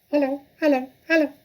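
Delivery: high squawky parrot imitating a human. Parrot greeting the children with a high squawk. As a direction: chirp it out like a talking bird. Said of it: "a voice came loud and clear".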